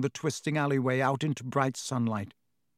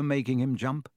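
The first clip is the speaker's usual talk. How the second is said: plain reading voice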